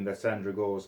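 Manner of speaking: plain reading voice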